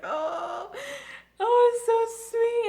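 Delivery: swooning fan squeal; gushing squeal. Retold or imitated. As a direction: melt over the cuteness. Retelling reaction to sweet scene.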